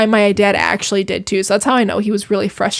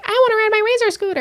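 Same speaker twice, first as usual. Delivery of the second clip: put-on high, fast, whiny little-kid plea; a little girl pestering her dad for her scooter; excited little girl; 12 semitones higher, 3 dB quieter, much faster than usual. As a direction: beg like an eager child. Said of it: "all excited".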